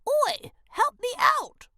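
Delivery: high, tiny squeaky shout; tiny shouting grub. A narrator's reading. A tiny grub in a web shouts to Elray for help. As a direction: call out like a tiny trapped grub. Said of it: "she heard a shout, the tiniest shout".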